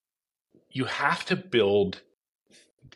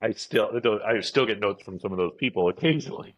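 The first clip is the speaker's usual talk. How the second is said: ordinary talk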